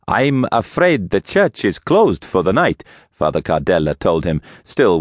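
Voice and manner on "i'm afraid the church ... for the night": put-on higher, accented priest voice; priest character. A narrator's reading. Priest turns late visitors away at the door, higher character voice. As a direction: politely put them off, pitched up.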